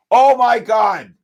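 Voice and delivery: loud, high, slow amazement; amazed. Real, not acted. Trader stunned by a member's big trade, loud amazement. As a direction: gasp it out in disbelief.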